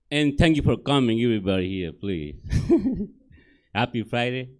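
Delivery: usual speaking voice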